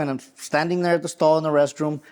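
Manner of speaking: ordinary talk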